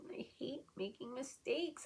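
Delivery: a choked, whispered confession; barely getting the words out through tears; tearful whisper. Real, not acted. Upset vlogger admitting to viewers she hates mistakes, tearful whisper.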